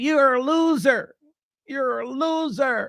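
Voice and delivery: high, slow, sing-song "you're a loser"; imitating a scornful woman, high and slow; mocking woman's voice. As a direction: drag it out to sting him. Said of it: "I've seen women call men".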